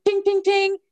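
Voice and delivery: high tings in quick succession; imitated lock clicks. Woman recalling all the doors unlocking, high imitated tings. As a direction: mimic locks popping open, high.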